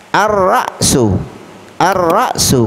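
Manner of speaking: slow "Ar-Ra'su", long rolled r, catch before su; al not read. Shown as a demonstration. Teacher models the example twice, "Ar-Ra'su" without l. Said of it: "Kenapa alnya tidak dibaca?".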